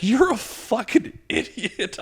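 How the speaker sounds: high, slow teasing jeer; mocking big brother. Older brother teasing the comedian, high and slow. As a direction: sneer it like a laughing older brother.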